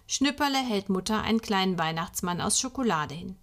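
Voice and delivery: ordinary delivery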